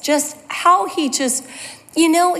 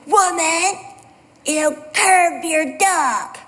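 slow, drawn-out mock scolding, pitched up; scolding cyclist; 3 semitones higher, much slower than usual. Cyclist to woman walking her dog, scolding. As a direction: imitate a cyclist's emphatic telling-off. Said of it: "Like that".

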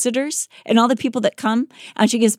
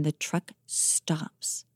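very slow, drawn-out suspense; dramatic slowdown; 4 semitones lower, 12 dB quieter, much slower than usual. A narrator's reading. Telling her cohost the crash moment, very slow suspense. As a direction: stretch "the truck stops" out to hold the listener. Suspense climax of the truck story.

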